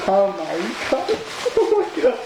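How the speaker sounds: high breathless oh my god with a laugh; awed delight. Real, not acted. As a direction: marvel at the glove, laughing. First touching the Freddy glove.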